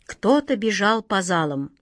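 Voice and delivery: ordinary delivery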